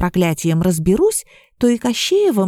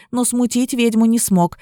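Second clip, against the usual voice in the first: plain reading voice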